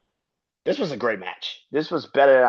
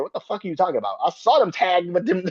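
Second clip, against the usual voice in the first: normal talking voice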